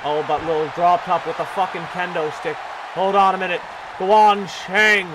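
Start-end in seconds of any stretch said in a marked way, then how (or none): none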